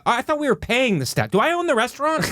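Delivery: high-pitched incredulous pushback; indignant retort. Retold or imitated. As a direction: question it in high, mock disbelief. Acted indignant retort to waitress.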